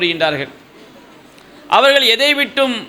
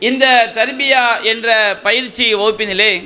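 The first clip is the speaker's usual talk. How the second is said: ordinary delivery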